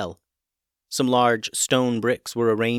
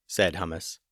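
ordinary delivery

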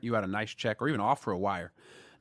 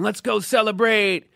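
excited friends; 11 semitones higher, 8 dB louder, slower than usual. Retold or imitated. Friends cheering after the final.

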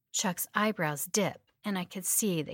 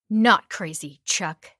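forceful; 9 dB louder, slower than usual. Acted. Captor answering loudly.

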